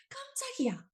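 a quick squeal of surprise; startled cry. Shown as a demonstration. Teacher acts the surprise again, high startled cry. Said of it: "이런 느낌으로".

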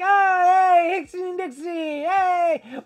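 loud, high, hammed-up cheering; imagined viewers cheering. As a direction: mimic overexcited fans, high and loud. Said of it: "you guys can be like".

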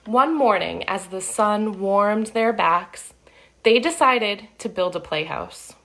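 put-on lively read-aloud for kids; storybook narration. A narrator's reading. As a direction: tell it like a picture book to children. Reading picture book aloud.

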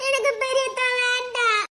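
slow, sobbing wail to mother; tearful childlike protest; crying refusal. Acted. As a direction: wail the refusal, dragging the words. Bawling girl refuses the match.

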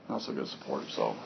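breathy whisper under his breath; whispered aside. Real, not acted. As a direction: mutter it to yourself, voiceless. Repeats complaint under his breath.

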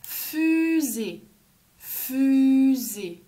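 long f and drawn-out first vowel; stretched-out word. Shown as a demonstration. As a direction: draw the word out for young learners. Teacher models word for children.

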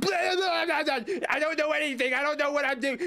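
clueless debut voice. Retold or imitated. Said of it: "And then I debut and it's".

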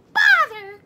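high, loud squeaky outburst; exasperated cry. A narrator's reading. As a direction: blurt it out annoyed. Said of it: "cried Mouse".

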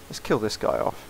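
ordinary delivery

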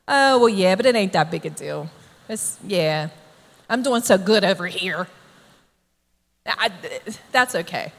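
low, soft, offhand shrug; minimizing. Acted. Sinner brushing off a sin brought to attention, minimizing. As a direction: play it down as no big deal. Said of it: "we minimize it".

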